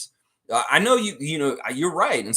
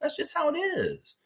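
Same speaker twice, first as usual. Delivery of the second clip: high, mocking voice telling him he's out; sing-song send-off, pitched way up; taunting the ousted coach in a squeaky mock voice; mock dismissal. Mock voicing the firing.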